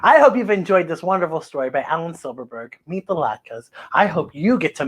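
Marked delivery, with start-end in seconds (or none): none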